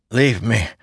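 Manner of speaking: croaked. A narrator's reading. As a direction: croak it like a wounded man. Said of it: "Adolin croaked, numb".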